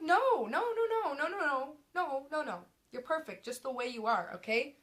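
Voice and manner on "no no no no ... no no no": emphatic no. Real, not acted. Rejecting insecure thoughts emphatically.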